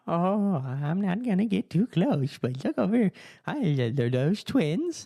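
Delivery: put-on high, prying old lady gushing; mock a nosy grandma peeking at babies; imitating a prying old woman. Mimicking nosy old women.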